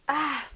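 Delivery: sudden loud sneeze; sneezing. Real, not acted. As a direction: let out one sharp sneeze. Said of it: "ich musste niesen".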